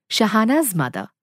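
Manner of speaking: usual speaking voice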